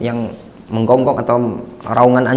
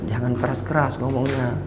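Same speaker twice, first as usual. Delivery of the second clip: much softer, quoted admonishment; hushed retold reminder; 10 dB quieter than usual. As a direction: gently imitate someone asking for less noise. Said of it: "selalu ada dikasih tahu". (menirukan teguran dengan suara pelan)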